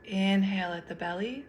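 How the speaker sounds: guided meditation. Acted. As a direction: lead them gently through each breath, unhurried.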